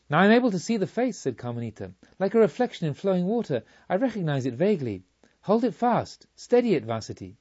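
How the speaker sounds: urgent excitement. Acted. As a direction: cry out as the face comes into view. Carmelita urgently sees the face.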